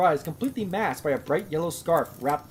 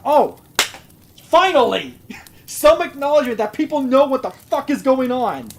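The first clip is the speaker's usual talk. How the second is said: loud, higher cry of relief at last; exasperated outburst; 7 semitones higher, 8 dB louder than usual. Real, not acted. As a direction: burst out, fed up and much louder. Exasperated reader reacting to the story.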